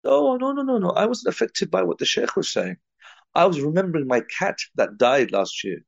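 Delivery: high, innocent protesting voice; the revert brother's reply. Retold or imitated. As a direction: pitch it up like a guileless man surprised by the praise. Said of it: "He said".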